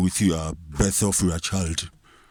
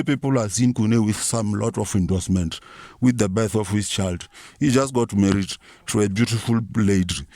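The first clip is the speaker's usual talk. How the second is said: ordinary talk, nothing marked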